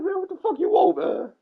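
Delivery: high, loud, laughing "what the fuck"; irritated mock retort. Answering the nosy neighbor, high laughing mock outrage. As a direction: snap back in mock outrage, cracking up.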